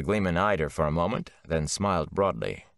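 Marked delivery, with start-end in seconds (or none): none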